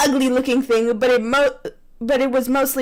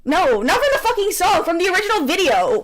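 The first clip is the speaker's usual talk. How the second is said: exasperated; 7 semitones higher than usual. Real, not acted. Exasperated correction.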